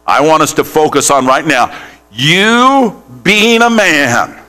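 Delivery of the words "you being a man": you being a man, drawn out slowly, each word weighted; slow emphatic phrase. Shown as a demonstration. Preacher stressing the key phrase for the congregation. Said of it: "Say that".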